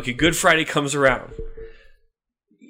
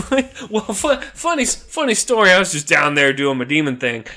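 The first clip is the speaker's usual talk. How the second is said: nervous minion. Acted. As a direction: stall before confessing bad news. Demon breaks bad news.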